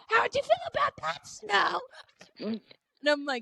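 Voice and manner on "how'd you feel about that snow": high-pitched mock taunt; mimicked taunt. Fan imitating the tribute in the arena, mimicked taunt.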